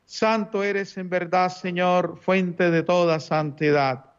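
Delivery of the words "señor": ordinary delivery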